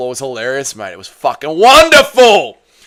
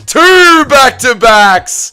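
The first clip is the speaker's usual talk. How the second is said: slow, stretched, much louder and higher shout; hyped shout; 8 semitones higher, 6 dB louder, much slower than usual. Real, not acted. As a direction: bellow it out in triumph. Hyped streamer celebrating back-to-back finishes.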